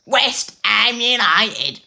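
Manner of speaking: very high, loud, slow Cockney cry; bad Cockney accent. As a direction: belt it out in hammy Cockney. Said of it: "that actually came out terribly".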